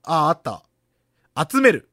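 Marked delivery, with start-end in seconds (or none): 1.5-1.9 集める read out, pitch high then dropping; 集める with a sharp fall; dictionary accent. Shown as a demonstration. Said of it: "下に下がる".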